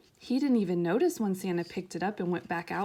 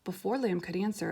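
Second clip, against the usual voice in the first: ordinary delivery, nothing marked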